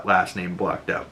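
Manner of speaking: usual speaking voice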